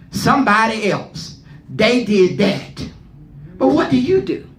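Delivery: normal talking voice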